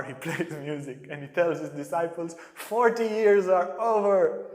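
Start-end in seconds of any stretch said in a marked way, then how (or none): 2.5-4.5 loud, slow, triumphant call; triumphant proclamation. Retold or imitated. Polly telling his disciples the ban is over, loud and triumphant. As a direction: announce it like a victory.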